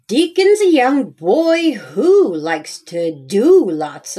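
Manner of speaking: put-on lively storybook read-aloud for little kids; reading to children. A narrator's reading. Reads picture book aloud to children.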